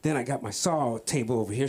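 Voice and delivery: ordinary delivery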